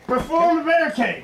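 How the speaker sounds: commander shout. Pretend. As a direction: order the defence. Commander gives orders.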